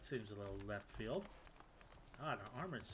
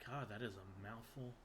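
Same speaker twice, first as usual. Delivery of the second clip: ordinary talk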